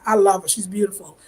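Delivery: normal talking voice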